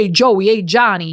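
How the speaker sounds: lightly imitated Brooklyn street greeting; old Brooklyn neighborhood accent. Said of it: "those were the people in your neighborhood".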